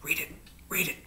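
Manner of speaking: whispered, very soft urging; murmured. A narrator's reading. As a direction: murmur it under your breath in a whisper. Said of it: "she murmured".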